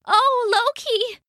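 high, feeble sigh of a goddess; weak. Acted. Ailing Sif greets Loki, weak and high. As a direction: sound drained and faint. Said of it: "Sif said weakly".

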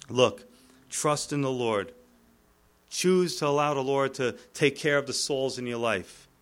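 normal talking voice

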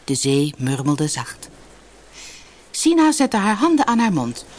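usual speaking voice

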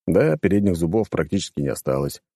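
ordinary delivery

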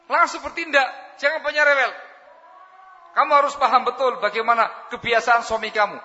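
loud, high, urgent sermon voice; forceful preaching. Acted. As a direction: drive the instruction home from the pulpit. Preacher exhorts wives forcefully.

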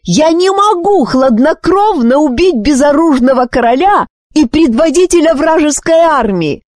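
high-pitched indignant outburst; queen's high, frantic rant; exasperated. A narrator's reading. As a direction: scold a fool in exasperation. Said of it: "простонала королева".

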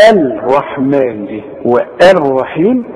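الرحمن and والرحيم slowly, the written "al" sounded before r; unmerged article. Shown as a demonstration. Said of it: "فما تقولش الرحمن". (الرحمن والرحيم ببطء بإظهار اللام)

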